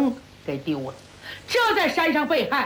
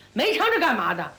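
usual speaking voice

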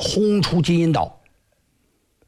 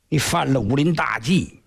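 ordinary delivery

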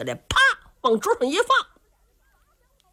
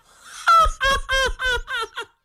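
high, slow wild belly laugh; villain laugh; 14 semitones higher, slower than usual. Acted. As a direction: throw your head back and cackle. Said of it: "尤俊达是仰天狂笑". (仰天狂笑)

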